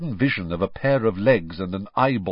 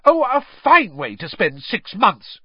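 higher, much louder shriek; 11 semitones higher, 9 dB louder than usual. A narrator's reading. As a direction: shriek it back at him. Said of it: "she shrieked".

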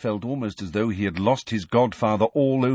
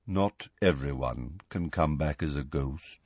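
grave quiet; 7 semitones lower, 7 dB quieter than usual. Acted. Nick gravely explains.

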